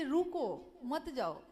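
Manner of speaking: रुको set apart, then मत जाओ together; pause after रुको. Shown as a demonstration. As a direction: break the phrase right after रुको. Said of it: "वाक्य बदल गया तो अर्थ बदला".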